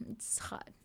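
near-whisper, very soft; quiet admission. Real, not acted. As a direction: let the voice drop away to a whisper. Admitting homesickness is hard.

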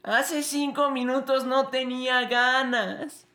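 put-on high, slow, whining child; whiny kid voice. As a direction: whine like a tired boy. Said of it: "la escena de".

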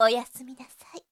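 a teasing whisper to send him to sleep; whispered goodnight. Acted. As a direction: breathe it into his ear as he drifts off.

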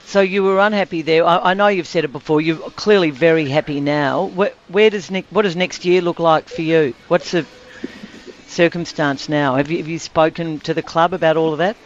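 usual speaking voice

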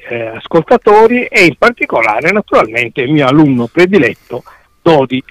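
usual speaking voice

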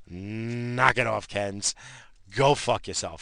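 usual speaking voice

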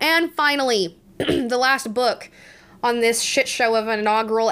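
ordinary talk

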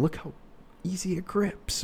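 high, soft, gushing over the pen; hammed-up rookie praising the pen; mock rookie pitch. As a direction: imitate a clueless new salesperson. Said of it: "or they will look at it and".

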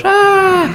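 high, loud shout of relief; a sharp high cry of relief. Retold or imitated. Friends crying out on his return.

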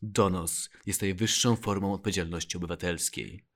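quiet, very low voice-over read; Łukasz Nowicki. Retold or imitated. Co-host imitating a narrator reading the motto, low and quiet. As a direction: read it like a soft-spoken TV announcer. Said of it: "chciałem takiego pana Łukasza, żeby nam czytał".

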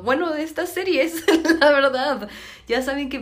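high, laughing delivery. Real, not acted. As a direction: giggle through it. Ríe al decirlo. (lo dice riéndose, agudo)

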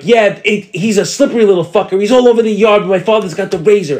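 high, excited prank-call babble; mock alarm. Prankster to the silent caller, mock alarm. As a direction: keep up the fake emergency.

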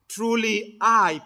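slow stress on I; Truly I with I held and weighty; emphatic I. Shown as a demonstration. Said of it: "and they are emphatic".

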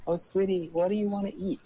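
high, loud, sugary host-parent voice; imitating friends' parents. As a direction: coo an offer like a friend's parent. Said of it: "having their parents be like".

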